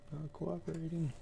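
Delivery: soft, high-pitched mutter; muttering to self. Real, not acted. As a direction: mutter it high and very softly to yourself. Muttering at the uncooperative slide controls.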